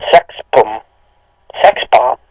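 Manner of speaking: ordinary delivery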